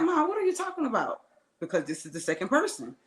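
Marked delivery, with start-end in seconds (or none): 0.0-1.2 shocked retort. Retold or imitated. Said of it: "So I'm like".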